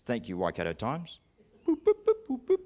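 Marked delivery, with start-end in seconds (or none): 1.7-2.6 high little boops of a dial; mimicked phone beeps. Preacher acting out his call to the medical centre, mimicked beeping. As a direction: imitate phone buttons being pressed. Imitates dialling a phone to the clinic.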